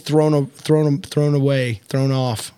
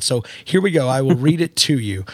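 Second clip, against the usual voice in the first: ordinary talk, nothing marked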